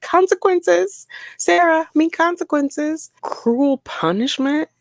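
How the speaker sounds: incredulous scoff. Real, not acted. Vlogger scoffing at the inmate's cruel punishment claim, high and incredulous. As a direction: scoff at the complaint, voice climbing high.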